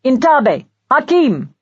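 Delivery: a touch of a soldier shouting; mild shout. Acted. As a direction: companion shouts in Arabic to crowd. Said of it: "His companion in Arabic shouted".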